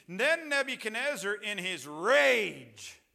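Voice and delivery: loud, high, drawn-out emphasis on RAGE; preacher's ringing, slow proclamation; forceful scripture reading. Shown as a demonstration. Scripture read with force, then repeated.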